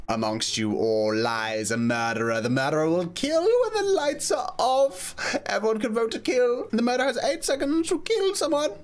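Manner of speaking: mock announcer reading the rules; lightly hammed game host. Reads game rules aloud.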